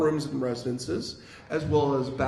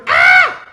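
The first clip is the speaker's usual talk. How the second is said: short, very loud high scream; firm startled scream; 16 dB louder than usual. Acted. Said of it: "eliciting a firm".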